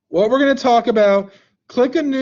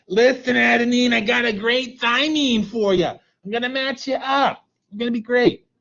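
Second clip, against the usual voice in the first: put-on grandmother voice, a little higher; grandmother matchmaker; 5 semitones higher than usual. Grandmother pitching a great thymine to adenine, put-on matchmaker voice. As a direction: play an eager grandmother making a match. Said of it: "Remember I called it like the grandmother".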